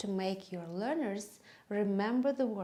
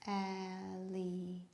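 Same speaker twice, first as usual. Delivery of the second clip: syllable by syllable; 3 semitones lower, 8 dB quieter than usual. Shown as a demonstration. Teacher marking four syllables for children, syllable by syllable. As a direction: count out alligator quietly, part by part. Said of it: "We have four syllables".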